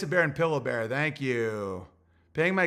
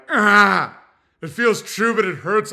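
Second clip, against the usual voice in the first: mock anguished outburst; 4 semitones higher, 9 dB louder than usual. Imitating someone overwhelmed by truth.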